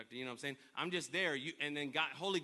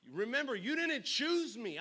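preaching; 7 semitones higher, 5 dB louder than usual. Acted. Scripture repeated, preached louder.

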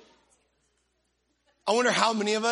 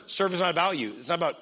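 ordinary delivery

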